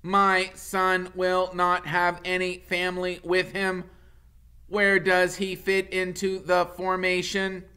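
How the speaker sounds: booming robot mother. Acted. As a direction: boom it out over the crowd. Roz questions the leader.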